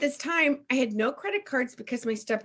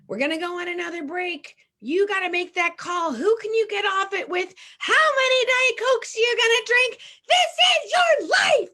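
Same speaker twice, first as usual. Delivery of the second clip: climbing higher and louder into a slowed shout; forum leader's rallying cry; 10 semitones higher, 6 dB louder than usual. Retold or imitated. Forum leader pushes the room to make that call, building shout. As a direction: whip the room up, louder with every line.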